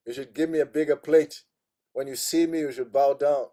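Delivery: lightly hammed-up proud monk; mock self-important demands; arrogant boast. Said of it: "If it was me".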